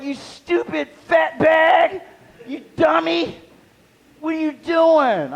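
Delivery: put-on high, slow, shouted taunt like a bratty gamer kid; mocking kid insult. Game kids yelling at him: high slow mock shout. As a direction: squeal the insult up high and drawn out.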